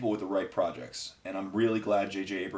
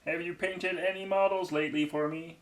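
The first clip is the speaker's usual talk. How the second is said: much higher put-on friend voice; higher goofy Andy voice; Andy impression; 9 semitones higher than usual. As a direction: ask it sweetly in your goofy Andy voice. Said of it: "That's basically Andy".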